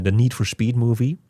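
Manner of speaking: normal talking voice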